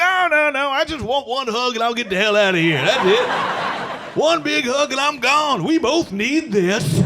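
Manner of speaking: high, laughing, begging mock plea; pleading for a hug. Grown man begging his child self for a hug, high laughing plea. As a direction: beg through the giggles. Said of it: "I'd be like".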